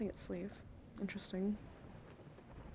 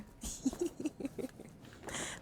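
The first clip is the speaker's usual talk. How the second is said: high, quiet giggle; real giggle; 7 semitones higher, 6 dB quieter than usual. Real, not acted. Streamer tickled by silly chat comment, soft high giggle.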